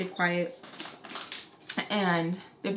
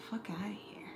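whispered, scornful aside; dismissive mutter; 12 dB quieter than usual. Real, not acted. Storyteller dismissing the shady cousin, whispered scorn. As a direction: drop to a whisper and wave it off.

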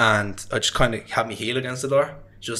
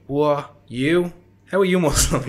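retold shock; 4 semitones higher, slower than usual. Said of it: "And everybody was shocked".

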